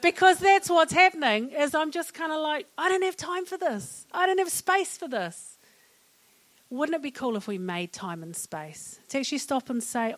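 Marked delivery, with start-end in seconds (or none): 2.8-5.6 high-pitched, insistent inner protest; acted overwhelm. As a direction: play someone with no room left for others. Acts out own overwhelmed thought.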